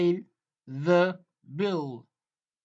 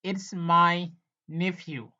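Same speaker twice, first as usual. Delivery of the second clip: slow teaching model; slower than usual. Shown as a demonstration. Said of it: "teacher repeats model sentence slowly".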